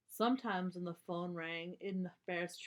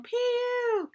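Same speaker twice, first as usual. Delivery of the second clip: a hammed-up "Pew!" much higher and louder; disgusted pew; 11 dB louder than usual. Girls react to the smelly chicken coop, hammed-up disgust. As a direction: wrinkle your nose at the stink.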